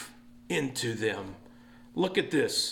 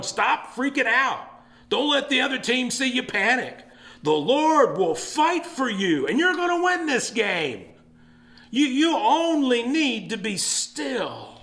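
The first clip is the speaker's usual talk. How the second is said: higher, slowed, punchy coach pep talk; football coach halftime speech; 7 semitones higher than usual. Retold or imitated. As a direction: fire up the team at halftime. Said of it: "like more of my football coaches".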